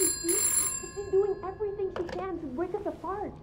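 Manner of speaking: shrill accusation. Acted. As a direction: cry out the accusation. Heated lovers' quarrel.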